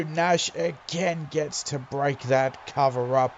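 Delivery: ordinary delivery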